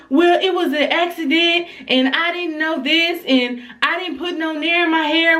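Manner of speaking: put-on high-pitched innocent kid voice; daughter telling her story. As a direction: mimic a child fibbing to her daddy. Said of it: "she tells him a whole different story".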